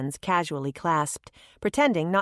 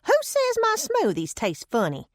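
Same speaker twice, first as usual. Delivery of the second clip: put-on much higher, indignant old lady; offended; 14 semitones higher than usual. A narrator's reading. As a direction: bristle at the insult. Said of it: "Taking offense".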